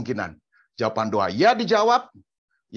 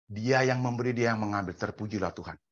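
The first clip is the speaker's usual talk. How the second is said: usual speaking voice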